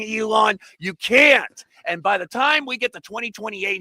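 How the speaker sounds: ordinary delivery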